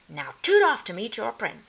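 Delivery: lightly voiced witchy godmother; God Witch. A narrator's reading. Godmother witch sends Cinder Hazel to the prince, lightly voiced. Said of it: "said the God Witch".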